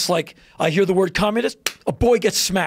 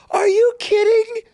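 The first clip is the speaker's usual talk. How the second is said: shrieking high, loud "Are you kidding?"; incredulous; 5 dB louder than usual. Retold or imitated. Mocking conservatives' praise of Putin with a high incredulous shriek. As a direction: squeal it in disbelief. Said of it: "I'm like".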